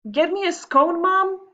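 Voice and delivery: put-on high, loud whiny kid voice; bratty boy demand. Boy demanding a scone from Mom, high whiny squeal. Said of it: "he said".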